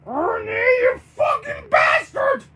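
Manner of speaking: high, loud furious yell. Acted. Bert yells at the baroness over a lie, furious yelling. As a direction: yell high and hard in outrage. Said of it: "Bert yells to Baroness von Von Bon".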